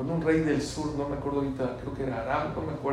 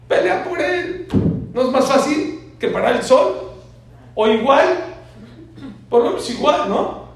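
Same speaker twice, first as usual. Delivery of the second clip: much higher, louder challenge; fiery rhetorical preaching; 10 semitones higher, 11 dB louder than usual. Acted. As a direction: press the crowd with the question.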